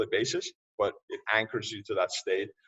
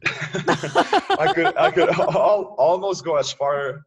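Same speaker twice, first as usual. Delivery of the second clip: words squeezed out mid-laugh, much higher and louder; laughing through words; 7 semitones higher, 8 dB louder, much slower than usual. Real, not acted. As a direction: confess it while cracking up. Guest laughs admitting he hates gym.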